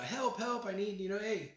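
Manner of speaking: high-pitched, casual plea for rescue; mock plea for help. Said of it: "he's just kinda like".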